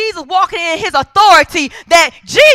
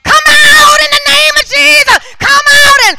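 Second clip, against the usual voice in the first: high, loud exorcist parody; hammed-up shouting, pitch pushed way up; mock dramatic shout; 8 semitones higher, 5 dB louder than usual. Preacher mocking showy deliverance ministers, overdramatic high shout. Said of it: "all of this extra drama".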